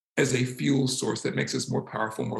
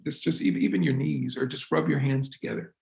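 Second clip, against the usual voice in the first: ordinary talk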